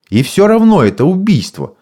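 high, firm stern rebuke. A narrator's reading. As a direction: say it sternly, like a judge. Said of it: "сурово сказал Рик". (сурово, твёрдо)

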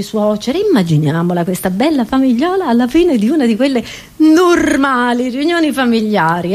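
ordinary delivery

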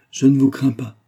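ordinary delivery, nothing marked

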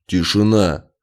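loud order for silence; urgent, commanding; breathless command. A narrator's reading. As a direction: silence the room at once. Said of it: "Тяжело дыша".

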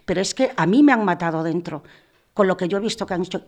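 ordinary talk

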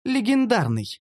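high, simple answer; elf answering. Acted. First answer, later repeated smugly.

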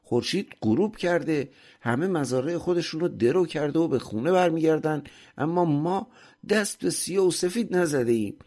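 high, pressing scolding; angry shout. A narrator's reading. Master rouses the sleeping servant at sunset, shouting and cursing. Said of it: "ارباب فریاد کشید".

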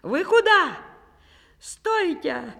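high panicked cry at his own legs; frightened shout. A narrator's reading. Ivan yelling at his runaway feet in fright. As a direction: yell in fright as the feet run off. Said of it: "кричит".

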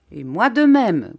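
loud, firm capping offer; concluding. A narrator's reading. Dupuis closing the bidding, loud and firm. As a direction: top the round off loudly. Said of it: "conclut monsieur Dupuis".

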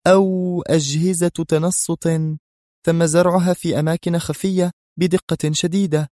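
high, deliberate suggestion; muttered resolve. A narrator's reading. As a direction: weigh it up with resolve. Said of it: "غمغمت منى وهي تستل مسدسها في حزم".

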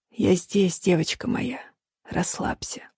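low, quiet rumbling voice; low rumble. A narrator's reading. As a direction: drop into a soft low rumble, fading to a whisper. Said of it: "Мой голос стал ниже, превратившись в глухой рокот". (низкий глухой рокот)

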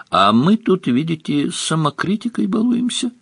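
high, climbing sheepish voice; embarrassed. A narrator's reading. Svarog caught talking to a statue, embarrassed. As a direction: explain yourself awkwardly, pitch rising to the end. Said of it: "смущённо сказал Сварог".

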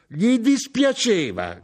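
very high, loud, drawn-out echo; outraged cry. A narrator's reading. Mother rejects son's sympathy for father, crying out. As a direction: wail the words back in disbelief. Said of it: "gridò mia madre".

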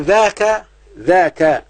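ذاك said clearly twice with a full final -ka; stressed kaf form. Shown as a demonstration. Teacher showing the middle-distance word, ذاك emphasised. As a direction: pronounce ذاك as dhaa-ka, emphatically. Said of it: "بالكاف كده".

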